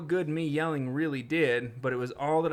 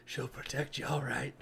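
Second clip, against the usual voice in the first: softer, darker mock threat; quiet menacing read; 7 dB quieter than usual. Shown as a demonstration. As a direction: mutter it like a threat. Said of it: "Maybe that's how it was".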